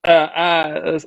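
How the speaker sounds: ordinary talk, nothing marked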